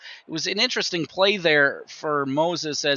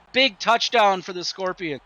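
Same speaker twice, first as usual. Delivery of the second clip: ordinary talk, nothing marked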